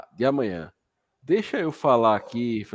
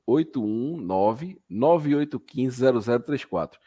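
over enunciating. Shown as a demonstration. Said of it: "Vou falar devagar pra você".

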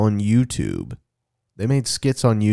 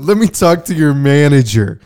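entitled customer impression; 5 semitones higher, 8 dB louder than usual. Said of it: "prank call scene".

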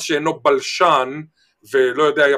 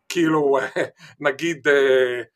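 ordinary talk, nothing marked

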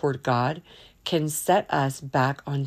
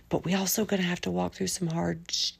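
hushed near-swear; 8 dB quieter than usual. Real, not acted. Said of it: "I just filtered myself".

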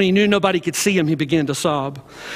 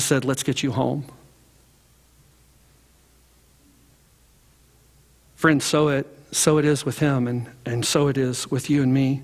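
hushed sermon close; 6 semitones lower, 3 dB quieter than usual. Acted. Preacher closing the story and blessing the congregation, hushed. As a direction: bring it down to a hush.

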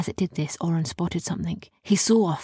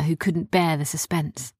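ordinary delivery, nothing marked

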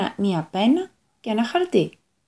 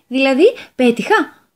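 hopeful devil; 5 semitones higher, 8 dB louder than usual. Acted.

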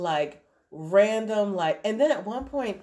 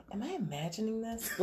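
soft, higher murmur to oneself; dazed wondering; 4 semitones higher, 10 dB quieter than usual. Retold or imitated. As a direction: quietly question your own senses. Mom's dazed thought at night.

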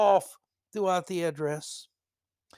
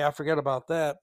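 usual speaking voice

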